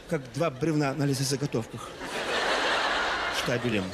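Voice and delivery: deadpan mock. Director mocks lying actors.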